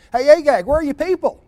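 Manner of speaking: mocking taunt. Saul jeers at Agag across the court, loud mocking call.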